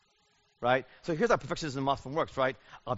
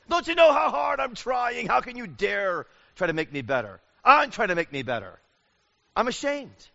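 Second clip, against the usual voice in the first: much higher and louder, indignant yelling; defensive shout; 8 semitones higher, 8 dB louder than usual. Retold or imitated. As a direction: snap back at the spouse. Perfectionist's defensive outburst repeated.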